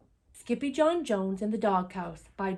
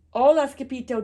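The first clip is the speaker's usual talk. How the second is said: louder, higher welcoming call; hearty greeting; 4 semitones higher, 7 dB louder than usual. A narrator's reading. Don Diego welcomes his old amigo, hearty greeting. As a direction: hail an old friend loudly.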